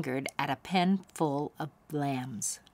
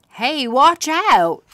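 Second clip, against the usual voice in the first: higher, louder, drawn-out call; warning shout; 8 semitones higher, 10 dB louder, slower than usual. Acted. Boy warning of the sheep, shouting. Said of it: "said Cameron".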